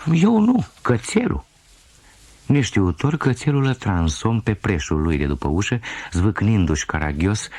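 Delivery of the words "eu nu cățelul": put-on little girl's denial. Girl blames the puppy.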